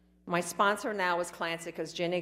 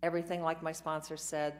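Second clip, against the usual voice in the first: ordinary talk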